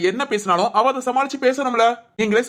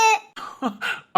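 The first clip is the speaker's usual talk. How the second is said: high laugh; 10 semitones higher, 4 dB quieter than usual. Real, not acted. Husband laughs.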